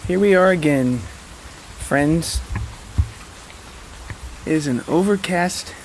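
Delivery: ordinary talk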